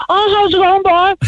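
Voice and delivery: high-pitched mock Cork accent; exaggerated, apologetically inaccurate Cork accent; attempted Cork accent. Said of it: "I can't do the accent".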